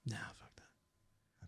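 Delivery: breathy whispered brush-off, very soft and slow; whispered dismissal. Real, not acted. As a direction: whisper it like a private put-down. Dismissive aside about moving.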